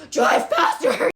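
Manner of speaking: loud, drawn-out squawk with laughter; mock frantic yell. Caller urging the officer to hurry, mock frantic yell. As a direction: yell it slowly, hamming up the panic.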